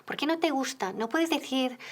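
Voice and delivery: normal talking voice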